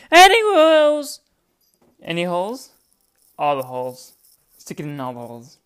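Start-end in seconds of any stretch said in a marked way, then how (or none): 0.1-1.2 much higher and louder, slow; loud repeat. Real, not acted. Testing a phrase while editing, loud and high. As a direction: belt out the question.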